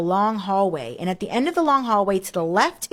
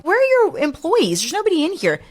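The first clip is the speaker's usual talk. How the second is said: retold bewilderment; 10 semitones higher than usual. Retold puzzled questioning at office.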